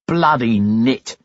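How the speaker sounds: uneasy, slow mutter of a sick-to-the-stomach mechanic; queasy. A narrator's reading. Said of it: "one of them said queasily".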